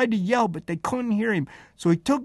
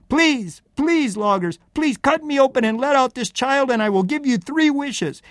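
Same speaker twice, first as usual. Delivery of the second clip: high, raised pleading call; crying out; 5 semitones higher, 4 dB louder than usual. Acted. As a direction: implore the loggers theatrically. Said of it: "I cried out".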